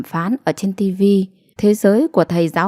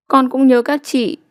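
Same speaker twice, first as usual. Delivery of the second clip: put-on higher, teary child's voice; a little girl sniffling as she answers; 6 semitones higher than usual. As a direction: say it through sniffles. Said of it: "Nó sụt sùi".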